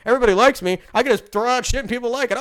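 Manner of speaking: ranting vlogger mimics the director bragging, smug and loud; mock smug director. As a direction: puff up and brag as the director, half-mocking. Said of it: "'Cause you're Denis Villeneuve".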